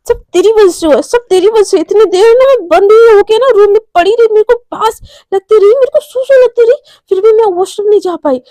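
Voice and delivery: high, loud whiny rant; exasperated blaming. Acted. Mishka blaming Romi for being stuck, exasperated rant. Mishka angrily blames friend for ordeal.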